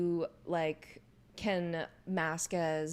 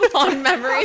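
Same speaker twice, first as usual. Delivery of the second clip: laughing; 6 semitones higher, 14 dB louder than usual. Real, not acted. Echoing with a laugh.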